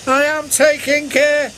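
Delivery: high, cheeky engine voice; replying James. A narrator's reading. James answering Edward's warning. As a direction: answer back cockily. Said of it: "replied James".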